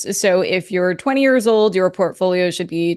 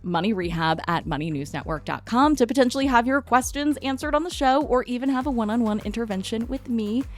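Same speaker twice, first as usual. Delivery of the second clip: softer podcast outro read; 3 semitones higher, 6 dB quieter than usual. Acted. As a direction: read the credits like a host. Reads show outro.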